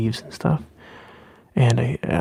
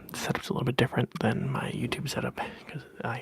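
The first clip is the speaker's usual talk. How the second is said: whispering; 7 dB quieter than usual. Acted. ASMR whisper chat.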